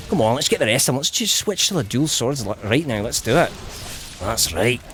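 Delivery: put-on easing off in a Scottish accent; mock Scottish gamer, calmer. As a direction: urge on the fight in a Scottish accent. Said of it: "Let's Play of this with a Scottish accent".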